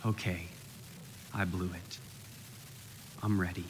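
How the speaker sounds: a humbled man admitting he failed; broken confession. Retold or imitated. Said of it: "Jonah's voice coming in".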